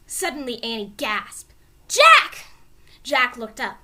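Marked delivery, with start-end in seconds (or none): 1.9-2.4 high, loud cry of alarm; alarmed shout. Acted. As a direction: yell his name in sudden fright. Annie gasps, calls Jack.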